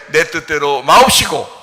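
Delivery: slow, earnest prayer quote; reverent prayer. Retold or imitated. Pastor reciting Jesus' prayer, slow reverent prayer. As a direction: pray it humbly and slowly. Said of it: "똑같이 기도해야지".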